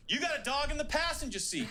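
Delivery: high, flat-out comeback; snappy comeback. Retold or imitated. Woman answering the driver's jab, high and pointed. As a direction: fire it right back at him. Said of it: "she looks back and she goes".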